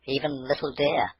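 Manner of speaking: plain reading voice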